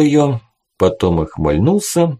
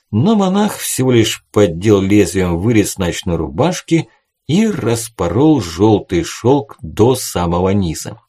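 plain reading voice

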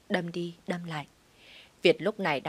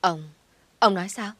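stammering in shock; 5 dB louder than usual. Acted. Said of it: "bà mới lắp bắp".

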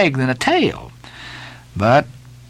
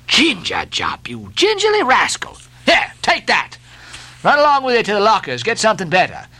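high, brisk scolding orders; scornful mate; 8 semitones higher than usual. Acted. Stubb sending the steward for better drink, scornful. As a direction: shoo him off with contempt.

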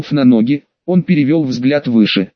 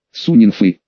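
distant booming voice; 6 semitones lower than usual. A narrator's reading. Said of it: "донёсся откуда-то издалека гулкий голос".

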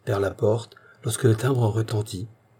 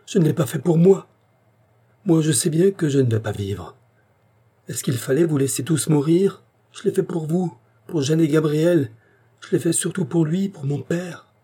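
breathless pleading; 6 semitones higher than usual. A narrator's reading. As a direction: gasp each phrase out, begging. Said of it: "d'une voix haletante".

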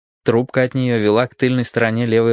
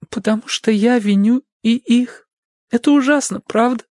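high, hurt woman's voice, bitter; wounded young woman; 12 semitones higher than usual. Acted. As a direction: voice her bandaged and ashamed. Narrator voices Sadie.

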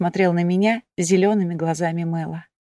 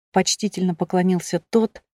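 plain reading voice

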